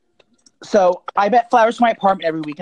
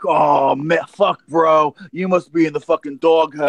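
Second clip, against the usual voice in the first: mock bro voice; 5 semitones lower, slower than usual. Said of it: "He's like".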